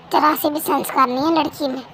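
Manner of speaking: usual speaking voice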